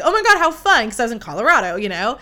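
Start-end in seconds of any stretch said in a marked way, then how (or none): 0.0-0.9 higher and louder burst of glee; excited delight. Retold or imitated. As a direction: gush over it like a thrilled tourist. Retold delight at the Coors bar.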